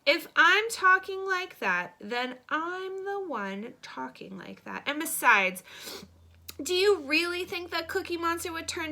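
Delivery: dead serious. A narrator's reading. Boy defending his monster talk to a classmate, dead serious. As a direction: argue it with earnest, drawn-out insistence. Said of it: "Oliver gets dead serious".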